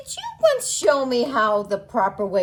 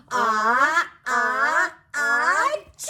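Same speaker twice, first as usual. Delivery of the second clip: building ah-ah-ah, then a big choo; hammed-up sneeze for kids; fake sneeze; 3 semitones higher, much slower than usual. Pretend sneeze in story.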